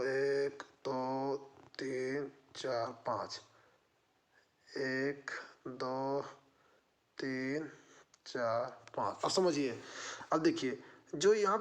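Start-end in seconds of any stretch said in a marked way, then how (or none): 0.0-3.4 low, soft, spaced-out counting; counting to oneself. Real, not acted. Counting to himself while drawing divisions. 4.7-9.2 low, soft, spaced-out counting; counting to oneself. Real, not acted. Counts the divisions a second time.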